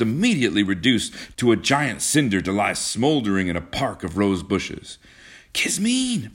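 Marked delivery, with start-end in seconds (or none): 5.5-6.3 high, pleading call of her name; urgent, high-pitched appeal; begging. A narrator's reading. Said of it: "begged John".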